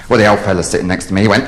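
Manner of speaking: usual speaking voice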